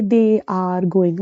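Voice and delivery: slow, word-by-word They are going; slow full form. Shown as a demonstration. Teacher showing how natives would not say it, slow and separated. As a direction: separate every word slowly, unlike a native speaker. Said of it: "वो ऐसे थोड़ी ना बोलेंगे".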